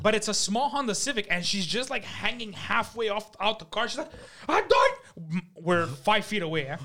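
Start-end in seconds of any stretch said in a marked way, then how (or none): 4.5-5.0 high, loud mock scream; shrill-pitched imitation yell; imitating screaming mom. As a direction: mimic an angry mother screaming from a car. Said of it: "She's clearly screaming".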